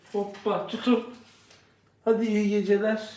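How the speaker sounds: ordinary delivery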